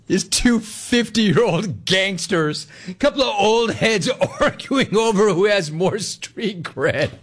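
high-pitched, laughing through the words. Real, not acted. Host repeats a caller's joke about old gangsters, laughing. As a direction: repeat it while cracking up. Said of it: "I'm just laughing because".

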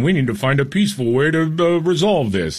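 a hint of a preachy politician, sing-song; mock mayor. Radio host mocking the mayor's call for peace, light mock tone. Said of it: "the mayor is out there saying".

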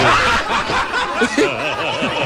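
high, long burst of laughter. Real, not acted. Laughs at memory. (carcajada aguda)

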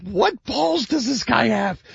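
high, drawn-out voice of disbelief; incredulous outburst. Retold or imitated. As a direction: squeal it slowly in mock amazement. Host voices his amazed reaction.

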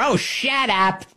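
high, slow laughing shut up; mock shut up, laughing. Host laughs at his own whiny mock voice. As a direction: drag out the oh and crack up.

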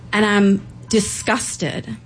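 ordinary talk

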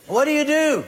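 demanding an answer from the crowd. Real, not acted. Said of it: "repeated louder".

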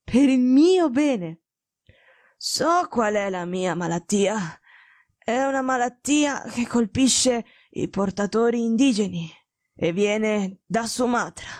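slow, labored sickbed voice; ailing, imperious detective. Acted. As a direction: explain the illness with failing strength. (malato grave, parla lento e affannato)

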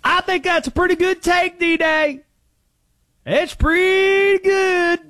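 high-pitched, drawn-out caller voice; Conspiracy Josh. Acted. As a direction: stretch it out high in a put-on accent. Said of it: "Conspiracy Josh here".

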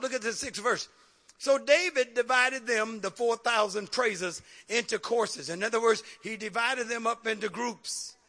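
normal talking voice

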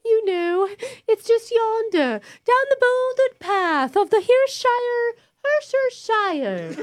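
fake British accent. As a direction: ham up a quaint English villager. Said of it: "English sounding".

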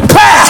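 mouth-made smack noise; punch sound effect. Retold or imitated. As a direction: snap out a sharp hit sound. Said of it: "So I went".

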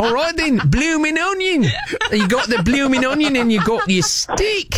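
high, hammed-up Aussie accent; shout-free but showy fake Aussie accent; Australian accent. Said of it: "I'm gonna do the accent".

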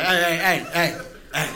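short dog-like yaps; dog talking to nobody; barking dog. Acted. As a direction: bark the word over and over. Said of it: "They're just like".

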